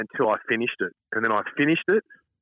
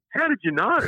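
put-on much higher, amazed little kid; toddler's voice. Caught toddler asking his dad, amazed and high. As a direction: squeak it like a caught two-year-old. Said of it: "looked at me and goes".

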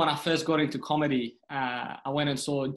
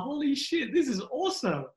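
high-pitched retold amazement; awed excitement; 11 semitones higher than usual. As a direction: relive the thrill of a packed room. Retells amazement at packed room.

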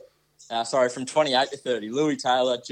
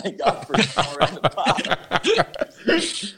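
punchline breaking into high laughter; words dissolving into real laughs; laughing; 7 semitones higher, 4 dB louder, much slower than usual. Real, not acted. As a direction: tease him while cracking up. Teasing soccer mum jibe, cracking up.

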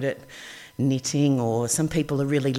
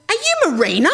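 eager question; 12 semitones higher, 7 dB louder than usual. Retold or imitated.

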